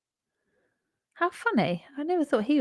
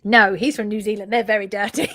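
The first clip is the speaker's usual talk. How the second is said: normal talking voice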